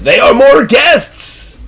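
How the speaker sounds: high, slow, drawn-out host voice; showman host. Acted. Zazelworth's odd reply.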